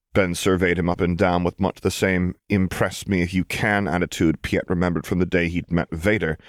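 ordinary delivery, nothing marked

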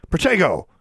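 high, sudden yell of an incantation; urgent shouted duelling spell. A narrator's reading. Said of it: "then immediately yelled".

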